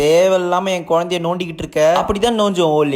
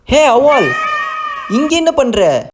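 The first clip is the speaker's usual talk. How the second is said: cheeky call-out; 6 semitones higher, much slower than usual. Acted. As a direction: call across the shop to the aunty, drawing it out. Daughter calls out to aunt.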